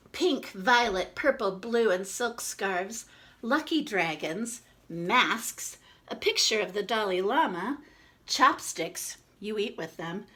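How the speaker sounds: lively storybook read-aloud; reading to children. Acted. Reads picture book to children.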